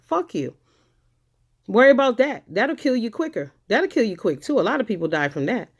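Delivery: normal talking voice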